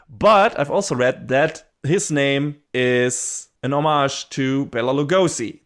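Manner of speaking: normal talking voice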